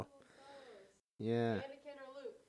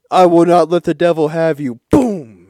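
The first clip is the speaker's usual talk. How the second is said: loud, raised proclamation ending in a blast; hammed-up divine decree; booming voice of God; 4 semitones higher, 9 dB louder than usual. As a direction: thunder it like God stepping in. God intervenes at last minute.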